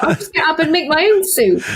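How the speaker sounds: high, quick self-reliant quip; mock independent brag; breezy defiance. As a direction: brush it off cheerfully. Retold own stubborn reply.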